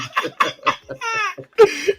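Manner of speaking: high, helpless laugh; storyteller overcome, laughing almost to tears; crying laughter. Real, not acted. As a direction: laugh till it nearly becomes tears.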